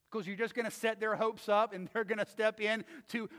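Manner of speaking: normal talking voice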